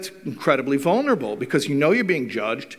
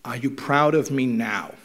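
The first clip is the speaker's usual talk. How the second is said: sincere plea; 4 semitones lower than usual. Retold or imitated. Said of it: "the kid says to the father".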